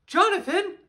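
high, loud shout of a name in shock; startled cry. Acted. Fletcher, stunned to see his teacher, crying out in shock. Fletcher wakes, sees Jonathan after crash.

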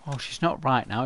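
ordinary talk, nothing marked

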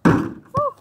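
loud high whoop of joy; excited whoop. Real, not acted. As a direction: cheer the made shot. Celebrating a made shot.

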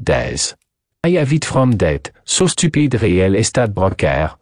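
put-on thick French accent, methodical reasoning; French detective, resolute. Detective explains his suspicions to his friend, French-accented. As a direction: play a French sleuth laying out his case.

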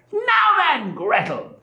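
high-pitched witch, out of patience; impatient witch cry. A narrator's reading. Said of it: "cried she to the little girl".

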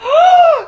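sharp, shocked high gasp; loud gasp. Acted. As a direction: gasp in horror at the crystal. Shocked at mom touching crystal.

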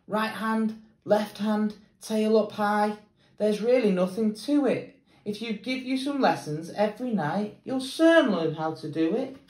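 high, cheerful coaching voice; Annie the acrobat. A narrator's reading. Annie teaching the mermaid to walk on her hands, high and cheerful. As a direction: coach her along, up and lively.

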